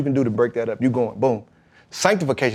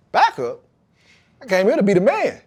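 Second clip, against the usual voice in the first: a retold outraged "backup?" that doesn't quite become a shout; indignant disbelief; 8 semitones higher than usual. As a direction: throw it back like you can't believe the news. Retold shock at being benched.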